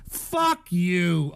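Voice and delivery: normal talking voice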